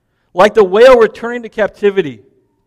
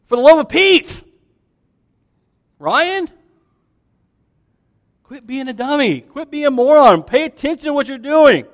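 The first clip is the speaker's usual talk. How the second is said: scolding friend; 7 semitones higher than usual. Retold or imitated. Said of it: "the story I told ... my friend Mike".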